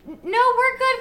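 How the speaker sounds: high, loud, incredulous brush-off; mock startled refusal. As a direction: turn the stranger down in loud disbelief. Retelling her startled refusal.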